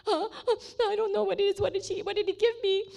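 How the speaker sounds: high, tearful re-enacted confusion; crying bewilderment. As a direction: whimper it, overwhelmed and clueless. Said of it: "And I was just crying".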